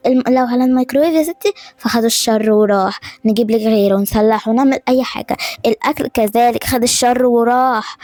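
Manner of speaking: ordinary talk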